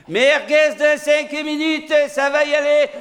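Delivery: voix haute et forte, lente, pour attirer la foule; appel de marchand. Acted. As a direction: crie l'offre de merguez comme au marché. Vendeur de merguez qui appelle. (le vendeur de merguez harangue la foule, voix haute et lente)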